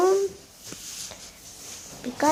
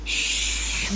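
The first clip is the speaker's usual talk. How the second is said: whispering while hiding; 4 dB quieter than usual. Real, not acted. Hushing so dog sleeps.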